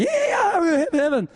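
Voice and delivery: "Yeah" leaps very high, a hammed-up cheer; mock rejoicing. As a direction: play the carefree believer cheering at the gates. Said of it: "you guys are gonna go rejoicing".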